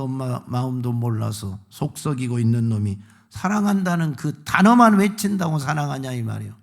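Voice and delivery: ordinary delivery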